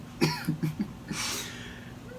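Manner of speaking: breathy, fond chuckle; amused laugh. Acted. Performer recalling his brother's Beethoven boast, amused laugh.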